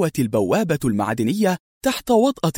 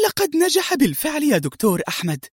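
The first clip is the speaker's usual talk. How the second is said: much higher, impressed voice; admiring exclamation; 7 semitones higher than usual. A narrator's reading. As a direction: exclaim his success with admiration, pitch raised. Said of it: "هتفت منى وهي ترمقه بإعجاب". (هتاف إعجاب بنبرة مرتفعة)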